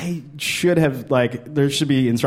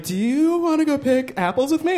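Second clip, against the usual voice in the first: high, sweet invitation; wife's invitation; 10 semitones higher than usual. Retold or imitated. Wife asks him apple picking on their third date, high and sweet. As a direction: ask brightly like a hopeful date. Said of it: "she was like".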